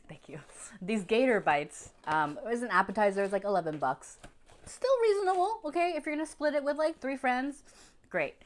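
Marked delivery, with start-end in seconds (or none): 0.0-0.4 soft whispered thanks; breathy aside whisper. Real, not acted. 4.7-7.0 excited. Real, not acted. Excited price pitch.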